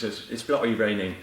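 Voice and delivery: put-on laughing Cockney accent; joking London Cockney. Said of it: "He does a great Cockney accent".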